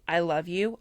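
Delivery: usual speaking voice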